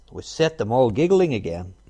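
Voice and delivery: plain reading voice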